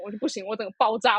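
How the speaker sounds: normal talking voice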